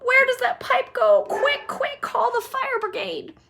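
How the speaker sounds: very high panicking voice; mother panicking very high; hysterical mother. Acted. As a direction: panic and urge haste. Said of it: "said Missus Gloop".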